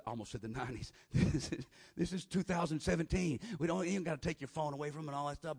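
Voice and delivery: normal talking voice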